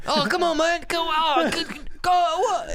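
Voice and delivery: high, loud Australian accent, exaggerated; mock Australian yell, very high; Australian instructor. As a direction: bellow it like a panicked Australian skydiving instructor. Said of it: "I think it's Australian".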